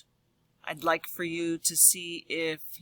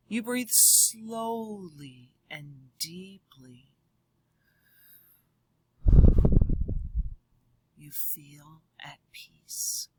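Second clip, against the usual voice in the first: calm whisper; 2 semitones lower, slower than usual. Acted. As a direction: breathe it out slowly, at peace. Page about calm.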